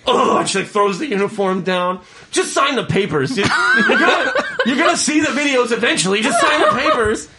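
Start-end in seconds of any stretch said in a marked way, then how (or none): 0.1-0.4 a high, indignant oh; huffy mock oh. To the job applicant, a high huffy oh. Hiring lady's huffy reaction. 2.3-7.3 higher, laughing impatient boss voice; mock annoyed manager. Hiring lady to the new hire, high laughing impatience.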